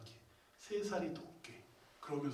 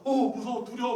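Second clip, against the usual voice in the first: acted fear; 7 semitones higher, 8 dB louder than usual. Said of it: "옆에 가지 못할 정도의".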